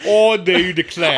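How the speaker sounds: loud mock-British declaration; British accent. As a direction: slip into a hammed-up British accent. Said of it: "like a British accent".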